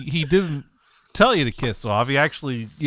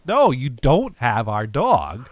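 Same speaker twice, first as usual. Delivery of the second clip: slow, deliberate mock insisting; slow, firm, lightly voiced mother; insistent mom; 3 semitones lower, slower than usual. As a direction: spell it out patiently to the town office. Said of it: "my mom had to convince them".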